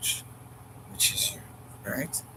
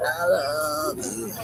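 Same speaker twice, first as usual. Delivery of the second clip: slow, drawn-out and tender; slow tender I love you; 5 semitones higher, much slower than usual. Pretend. Speaker telling the one they love, slow and tender. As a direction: linger on each word lovingly.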